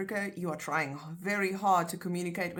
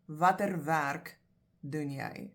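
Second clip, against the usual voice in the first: slow demo; slower than usual. Shown as a demonstration. Teacher showing learners the right Afrikaans question, slowly. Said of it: "you'll say".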